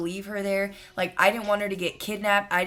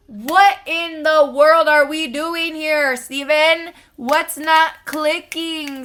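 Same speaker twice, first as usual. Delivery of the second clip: higher, louder, slow incredulous rant; loud, slow, disbelieving tirade; exasperated scolding; 7 semitones higher, 8 dB louder, slower than usual. Retold or imitated. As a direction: demand answers from Steven, fed up. Scolding friend's boyfriend Steven.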